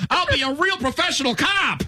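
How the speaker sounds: high, hammed-up eager cop; mocking impression. Host mocks the contestant's film summary, high put-on voice. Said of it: "him trying to earn his stripes".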